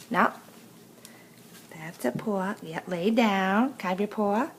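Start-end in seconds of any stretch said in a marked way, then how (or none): none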